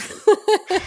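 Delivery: a burst of high, loud laughter; bright laugh. Real, not acted. Laughing at her own remark.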